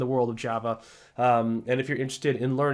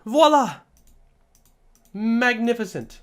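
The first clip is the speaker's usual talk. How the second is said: high, theatrical flourish; hammed-up admiration; showy triumph; 7 semitones higher, 5 dB louder than usual. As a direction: announce it like a showman. Showy reveal of finished tables.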